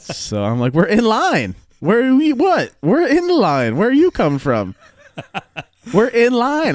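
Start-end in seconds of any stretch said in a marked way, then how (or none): 0.7-4.8 high, loud exasperated protest; mock indignant shouting. Arguing back at the old lady over the line, high mock shout. 5.9-6.8 high, loud insistent protest; mock exasperated yelling. Repeating himself to the old lady, exasperated mock shout.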